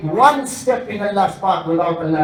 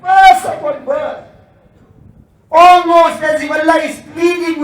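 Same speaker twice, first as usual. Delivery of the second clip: impassioned preaching; 8 semitones higher, 8 dB louder than usual. Acted. Preacher stirring the congregation, loud and passionate. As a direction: let it build into a fiery crescendo. Preacher rising to full force.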